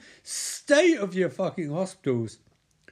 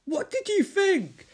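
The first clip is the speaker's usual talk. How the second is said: shrill retort; slower than usual. Acted. Freddie shouting back at Will, very high and indignant. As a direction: throw it back at him, outraged.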